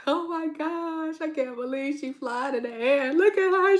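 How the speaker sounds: high, teary overwhelmed voice; tearful mock awe. Fan watching Defying Gravity, high and teary. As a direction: act out being moved to tears by the song. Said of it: "I was like".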